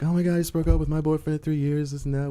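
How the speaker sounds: a hint of a chatty girl spilling news; young woman at bar. Teller imitating the girl describing her breakup, lightly voiced.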